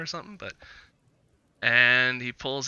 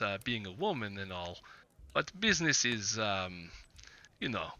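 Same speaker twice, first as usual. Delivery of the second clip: lightly voiced Vistani trader, measured and hesitant; Vistani businessman; 4 dB quieter than usual. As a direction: play a guarded businessman, feeling out each phrase. Game master voices Vistani businessman.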